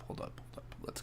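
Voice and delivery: breathy unvoiced whisper. Real, not acted. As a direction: hush it down to a whisper. Drops to a whisper.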